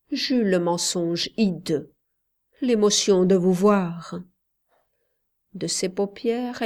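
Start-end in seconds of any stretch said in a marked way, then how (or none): none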